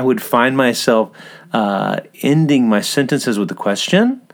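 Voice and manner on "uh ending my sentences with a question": rising question tune at the end, Australian style; Australian upspeak. Shown as a demonstration. Host demonstrating Australian upspeak, a light rising tune. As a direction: let "question" lift up like an Aussie would. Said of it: "like I just did right there".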